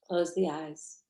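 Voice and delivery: guided meditation. Real, not acted. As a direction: guide them gently through the breath, unhurried.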